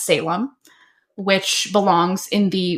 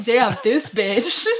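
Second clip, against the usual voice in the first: mock scorn; 5 semitones higher, much slower than usual. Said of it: "You're like".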